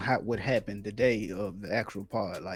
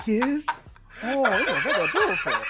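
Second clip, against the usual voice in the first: high, louder mock chatter; lightly voiced stranger; retold exchange; 11 semitones higher, 6 dB louder than usual. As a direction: replay the odd dialogue with a grin. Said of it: "I said".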